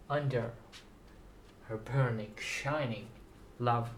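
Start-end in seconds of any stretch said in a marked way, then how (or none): none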